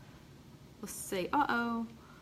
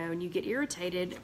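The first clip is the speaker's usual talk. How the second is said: usual speaking voice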